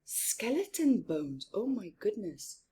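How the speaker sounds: plain reading voice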